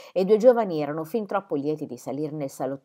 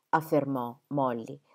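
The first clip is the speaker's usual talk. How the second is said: ordinary delivery, nothing marked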